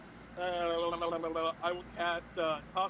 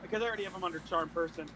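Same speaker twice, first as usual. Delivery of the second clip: normal talking voice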